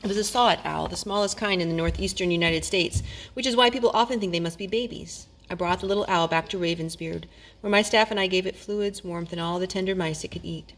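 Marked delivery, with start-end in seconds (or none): none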